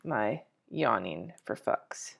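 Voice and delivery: usual speaking voice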